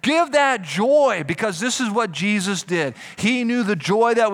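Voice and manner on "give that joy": drawn out and louder; deliberate preaching emphasis; slow exhortation. Real, not acted. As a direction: lean on each word. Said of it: "slow emphatic exhortation".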